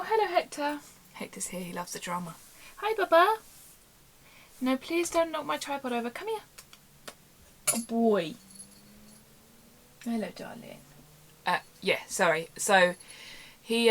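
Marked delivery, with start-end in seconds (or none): 0.0-0.8 high pet-talk greeting; cooing to a pet. Real, not acted. Greeting her cat. 2.8-10.8 very high cooing at the cat; sweet high voice for a pet on the tripod. Real, not acted. Talking to her cat.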